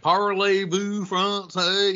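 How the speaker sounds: high, drawn-out bad French accent. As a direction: butcher the French slowly and loudly. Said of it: "not that my French is, is very good".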